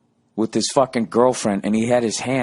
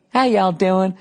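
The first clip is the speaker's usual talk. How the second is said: high Southern-hospitality greeting, lightly mocked; mock-friendly, high-pitched Kentucky hello; fake polite; 10 semitones higher, 3 dB louder, slower than usual. Local greets a visitor he secretly hates, fake polite. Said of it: "everybody's there is polite".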